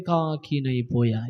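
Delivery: low, slow, drooping voice; mock-forlorn lament. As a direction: voice the lonely prophet sinking into self-pity. Voices the prophet's lonely lament.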